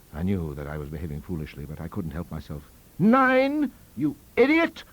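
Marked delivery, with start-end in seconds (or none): 3.0-4.8 high, loud outraged outburst; furious shout. A narrator's reading. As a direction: explode at a fool. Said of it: "I shouted".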